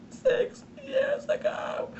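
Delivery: very high, drawn-out squeak; high-pitched mock-distressed whine; mock wail. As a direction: squeal it slowly, like a playful cry over feeling old. Mock wail at feeling old.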